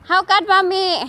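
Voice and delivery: high, loud imitation of daughter's accent; Jessica's accent. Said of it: "Same kay Jessica yung accent".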